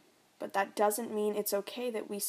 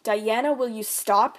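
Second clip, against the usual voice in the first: loud, exasperated snap; irritated dad; 8 dB louder than usual. Acted. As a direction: cut her off sharply. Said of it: "he says".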